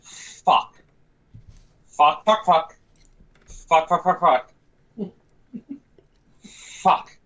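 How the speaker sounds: repeated curse chanted to oneself, with pauses between bursts; mantra-like. Acted. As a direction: mutter it over and over like a mantra. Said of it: "Almost mantra-wally going".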